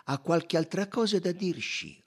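high, loud and insistent; firm detective reply. A narrator's reading. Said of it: "replicò Poirot con fermezza".

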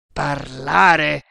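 loud, defiant roar; roared in outraged defiance. A narrator's reading. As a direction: roar the word back at your torturer. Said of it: "ruggì Manciadi".

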